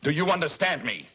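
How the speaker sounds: father shouting at his daughter; angry demand. Acted. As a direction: force an answer from her.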